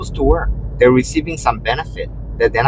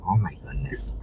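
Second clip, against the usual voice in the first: whispered, much softer; 7 semitones lower, 12 dB quieter than usual. Retold or imitated. Me reacting to the yelling guy, whispered. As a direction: breathe it under your breath. Reaction after being yelled at.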